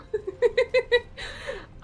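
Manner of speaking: high, loud burst of laughter. Real, not acted. Amused by content warning question.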